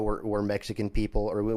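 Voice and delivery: ordinary talk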